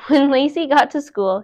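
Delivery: ordinary delivery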